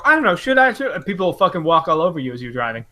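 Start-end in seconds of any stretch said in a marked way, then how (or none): 0.0-0.9 high, wavering mock indecision; hesitant dithering. Imitating a timid driver unsure whether to go, hesitant. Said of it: "if you don't, if you kinda go".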